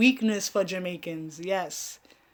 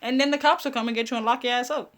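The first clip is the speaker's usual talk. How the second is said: higher mock voice of the family; family warning; 6 semitones higher, 3 dB louder than usual. Family warning her about the show, mock higher voice. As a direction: voice her relatives scolding her, high-pitched. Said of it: "They were like".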